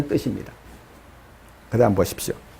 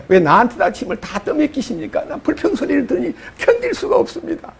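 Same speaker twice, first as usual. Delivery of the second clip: high, half-laughing lament; exasperated whine; 7 semitones higher, 4 dB quieter than usual. Retold or imitated. Moses complaining to God, high laughing lament. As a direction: moan it out, near laughing in despair.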